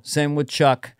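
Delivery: ordinary delivery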